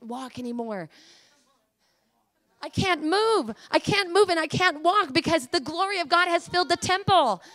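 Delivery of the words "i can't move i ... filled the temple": impassioned, raised preacher voice; fervent preaching. Real, not acted. Preacher testifying that the glory has filled the temple, fervent. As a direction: proclaim it with full conviction.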